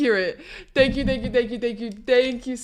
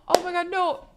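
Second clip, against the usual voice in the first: high-pitched cooing with a gasp; touched surprise; 8 semitones higher, 3 dB quieter than usual. Real, not acted. Reacting to anniversary wishes with a gasp.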